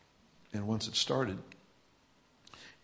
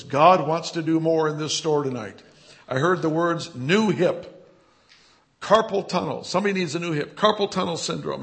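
loud, higher, proclaiming to the whole store; intercom announcement; 4 semitones higher, 9 dB louder than usual. Retold or imitated. Young man tells shoppers what God wants, loud intercom announcement. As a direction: announce it boldly as if into a store microphone. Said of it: "He takes the microphone back and he says".

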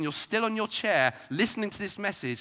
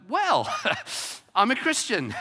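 high, louder acted answer with a laugh; retold gleeful reply; 7 semitones higher, 4 dB louder than usual. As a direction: burst out brightly as the retold believer. Voicing a believer explaining the healing.

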